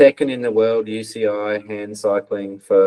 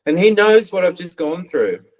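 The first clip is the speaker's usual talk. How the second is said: relieved laughing; 8 semitones higher than usual. Retold or imitated. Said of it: "I just pissed myself laughing because I was like".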